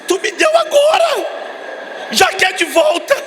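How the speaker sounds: hammed-up divine rebuke; mock voice of God. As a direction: play God, amused and indignant. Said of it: "Deus falou assim".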